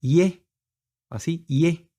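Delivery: "Y" as a soft gliding ee-eh; soft Y demo. Shown as a demonstration. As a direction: glide from an I vowel into e on "Y". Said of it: "se pronuncia como una Y".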